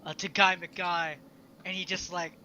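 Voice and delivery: ordinary talk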